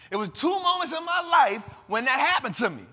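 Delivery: loud emphatic declaration; shouted. Real, not acted. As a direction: proclaim it at full voice. Repeating it louder, shouting.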